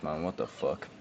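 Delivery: ordinary talk, nothing marked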